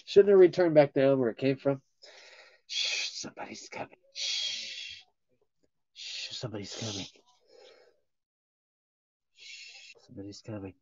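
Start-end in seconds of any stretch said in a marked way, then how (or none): 2.7-10.7 hushed unvoiced whisper; soft secretive whisper. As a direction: whisper it like someone's sneaking up. Whispered warning bit.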